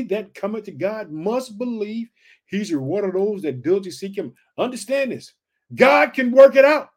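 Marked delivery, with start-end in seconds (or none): 5.7-6.9 high, loud declaration; full-force preacher cry; preaching shout. Real, not acted. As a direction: belt it out to the congregation. Preacher's high point of the sermon.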